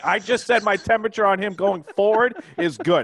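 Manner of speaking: loud, high-pitched angry protest. Real, not acted. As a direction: defend yourself, really heated. Heated podcast argument over quote.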